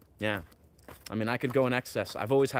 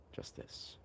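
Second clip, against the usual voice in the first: breathy whispered echo of just this; 18 dB quieter than usual. Shown as a demonstration. Echoing Ram Dass's words in a hushed whisper. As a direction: whisper it back like a mantra. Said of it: "a nice play on words with justice and just this".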